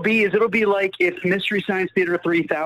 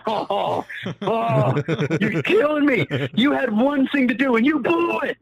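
mock yelling; 6 semitones higher, slower than usual. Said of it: "I'm, like, screaming at him".

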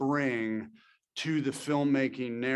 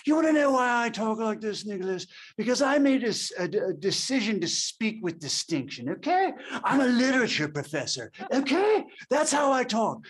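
higher, hammed-up mid-Atlantic professor voice; exaggerated mid-Atlantic father defending how he speaks; August Coppola's grand mid-Atlantic accent; 9 semitones higher than usual. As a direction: play Dad's mid-Atlantic diction big, with a lofty literature-professor manner. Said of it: "He goes".

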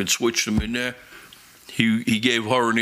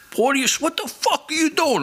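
much higher, louder furious yell; furious sergeant; 3 dB louder than usual. Retold or imitated. Angry sergeant yells at the detective on the phone, furious. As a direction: scream it like an enraged sergeant. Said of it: "And he's going".

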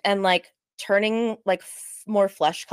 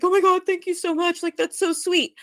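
high, gushing thank-you; grateful gushing friend; 9 semitones higher than usual. Retold or imitated. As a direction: gush with relief and thanks. Friend thanks her, voiced gratefully.

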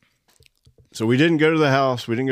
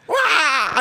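high yelped wah, hammed up; a laughing imitation of his own scream; mock wah of fright; mock startled scream; 14 semitones higher, 3 dB louder than usual. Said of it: "I screamed first".

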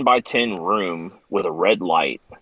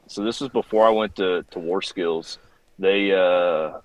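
normal talking voice

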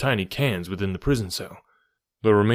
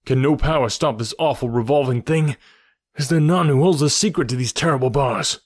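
raised, higher pleading cry; desperate cry; 5 semitones higher, 5 dB louder than usual. A narrator's reading. Hero cries to Zodar to stop the turning cell, desperate loud plea. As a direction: cry out against the bars in despair. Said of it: "I cried".

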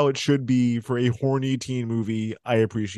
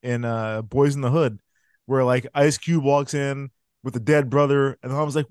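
ordinary talk

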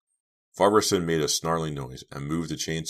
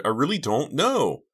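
high, loud pleading cry; frightened shriek; desperate scream; 11 semitones higher, 6 dB louder than usual. Acted. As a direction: scream it, begging to be believed. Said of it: "Dina screamed".

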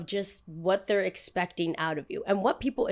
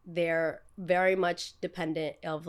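ordinary talk

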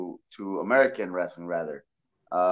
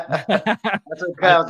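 laughing; 7 semitones higher, 6 dB louder, much slower than usual. Real, not acted. Laughs at host's bad Spanish.

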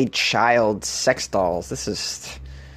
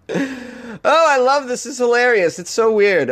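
high-pitched, amused gushing; tickled and excited; delighted; 10 semitones higher than usual. Real, not acted. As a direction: react with real delight, pitch jumping way up. Delighted reaction to silly letters.

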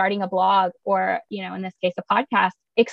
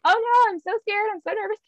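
mock fear; 12 semitones higher than usual. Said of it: "I was just like".

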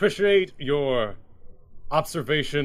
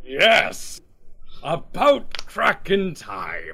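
loud, higher, slow gleeful bark; eager for a fight; 4 semitones higher, 4 dB louder, much slower than usual. Acted. Bartender gleefully welcoming the drunk's challenge. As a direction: relish the coming brawl. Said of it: "looking at him like".

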